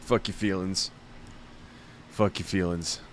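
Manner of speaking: normal talking voice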